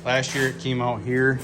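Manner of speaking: ordinary delivery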